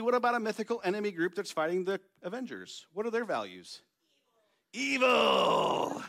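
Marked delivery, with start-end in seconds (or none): 4.7-5.1 villain voice. Real, not acted. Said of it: "Very good villain voice". 5.1-6.0 deep booming villain shout. Real, not acted. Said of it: "Very good villain voice".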